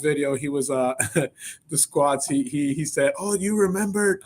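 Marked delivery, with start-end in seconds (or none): 3.2-4.2 higher-pitched imitation of a Mexican accent; mock Mexican-accented reminiscing; Rob's Mexican accent. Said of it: "he had the full on accent".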